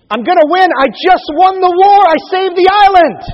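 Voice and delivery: high, loud and sure of victory; confident boast. Retold or imitated. Churchill, sure he'll be re-elected, boasts loudly. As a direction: crow it like a war hero certain of re-election.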